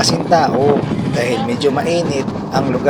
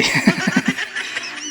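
high giggle; 4 dB quieter than usual. Real, not acted. Neighbours gossiping, laughs.

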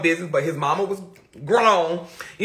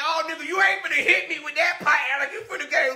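fake bravado; 6 semitones higher, faster than usual. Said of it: "I'm trying to act like I ain't scared".

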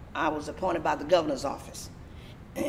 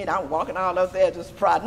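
ordinary talk

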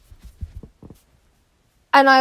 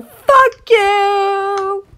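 defiant mock yell; 7 semitones higher, 4 dB louder than usual. Defiant yelling at the cop.

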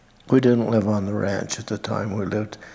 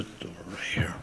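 soft unvoiced whisper, gently reassuring; hushed whisper telling him it will be easy; 4 semitones lower, 10 dB quieter, faster than usual. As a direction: whisper it like a comfort at a deathbed. Reassuring dying friend at bedside.

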